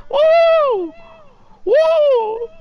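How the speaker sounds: very high, loud whoop of joy; excited woo. Real, not acted. Host whoops, hyped that Aaliyah is streaming, high and loud. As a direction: let out a big celebratory woo.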